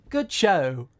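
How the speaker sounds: put-on British voice. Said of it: "I put on a voice and I say".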